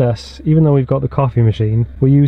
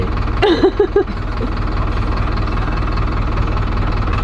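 high giggle. Real, not acted. Laughing at Siri joke.

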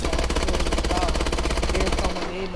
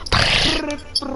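"Peripheral" higher, louder and slow, falling at the end; loud mocking echo; 6 semitones higher, 5 dB louder, slower than usual. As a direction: belt out "Peripheral" to tease.

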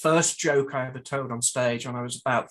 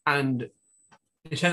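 normal talking voice

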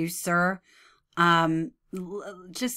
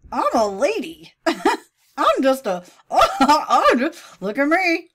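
high, swooping mock lady voice; parody; 6 semitones higher, 5 dB louder, slower than usual. Commentator improvising a parody, high hammed-up lady voice. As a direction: ham it up, breaking into laughs. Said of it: "come up with parodies".

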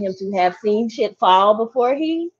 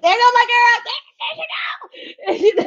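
excited cheer; 13 semitones higher, faster than usual. Retold or imitated. Fan cheering when her favorite character's name comes up, excited. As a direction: shout it like your favorite just walked in.

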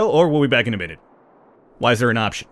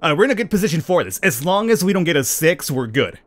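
ordinary talk, nothing marked

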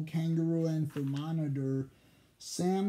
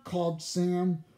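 ordinary delivery, nothing marked

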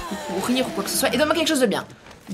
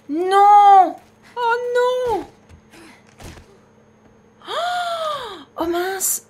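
cri aigu de panique; glapit, totalement affolée; 10 semitones higher, 5 dB louder, much slower than usual. Real, not acted. Fuit la horde en panique. (cri aigu paniqué)

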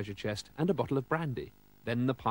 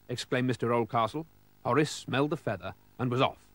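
ordinary delivery

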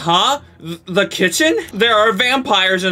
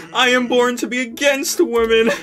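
usual speaking voice

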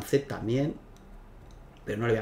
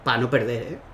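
usual speaking voice

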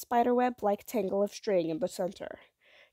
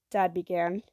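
ordinary delivery

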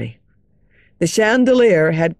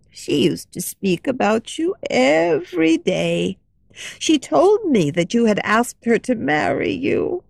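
a lightly voiced kindly old lady, hushed; gentle old lady sharing a secret; old woman; 6 semitones higher than usual.

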